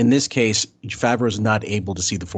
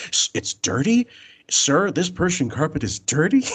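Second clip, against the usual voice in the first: much higher, stunned repeat of it's dirty; incredulous disbelief; 5 semitones higher than usual. Retold or imitated. Crew guy repeating the manager's complaint, stunned and much higher. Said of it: "He goes".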